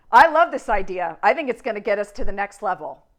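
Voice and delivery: enthusiastic. Shown as a demonstration. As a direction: really sell it with excitement. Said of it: "See the difference?".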